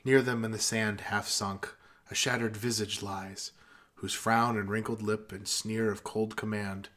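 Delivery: soft, solemn recital; poem recitation. Acted. Reciting Ozymandias to friends in a solemn, soft voice. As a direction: recite it gravely, like Cranston.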